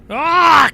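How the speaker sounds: loud, high "ah" of exasperation; exasperated groan. Pretend. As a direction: groan loudly at the car ahead. Driver exasperated at traffic.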